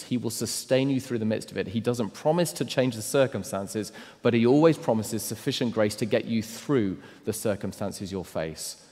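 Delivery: ordinary talk, nothing marked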